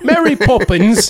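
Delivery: high, slow put-on English voice; a hammed-up British Mary Poppins; mock British accent. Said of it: "British accent".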